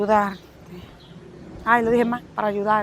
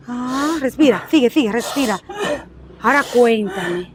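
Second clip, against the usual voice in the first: softer, soothing respira; calming a friend down; calming breath coach; 6 semitones lower, 5 dB quieter than usual. Acted. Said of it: "a ver, respira".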